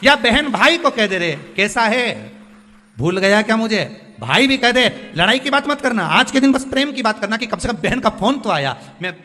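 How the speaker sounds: loud, high preacher's appeal; impassioned preaching. Acted. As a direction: urge the congregation with full force. Preaches at full force.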